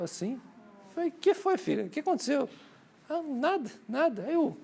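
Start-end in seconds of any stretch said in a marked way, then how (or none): 1.1-2.5 put-on high-pitched dad asking his little girl; much higher pitch, talking to a small child; father questioning child. Father asks his little daughter what happened, high-pitched questioning. 3.1-4.2 imitated little girl, high and dodging; evasive little child. Daughter answers her father after being caught, high evasive child voice.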